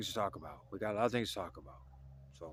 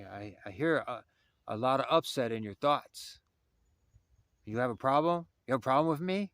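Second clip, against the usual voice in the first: challenging elder; 5 semitones higher, 6 dB louder than usual. Retold or imitated. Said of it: "and he says".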